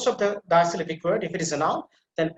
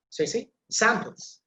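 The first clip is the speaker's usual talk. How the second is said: ordinary talk, nothing marked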